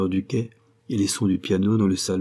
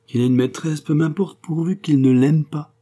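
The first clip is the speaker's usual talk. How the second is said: higher-pitched, haughty dismissal; scornful, emphatic mother; disdainful retort; 7 semitones higher, 5 dB louder than usual. A narrator's reading. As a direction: wave it away with lofty disdain. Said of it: "répliqua-t-elle dédaigneusement".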